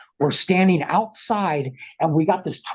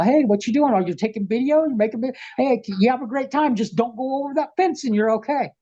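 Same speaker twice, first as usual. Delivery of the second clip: much higher-pitched, easygoing officer impression; raised-pitch mock police chat; imitated friendly cop; 8 semitones higher than usual. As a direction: play a cop casually waving someone on. Acting friendly cops pulling up.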